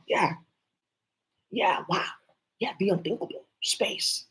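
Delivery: ordinary delivery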